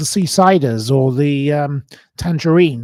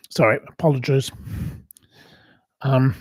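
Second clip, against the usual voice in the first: normal talking voice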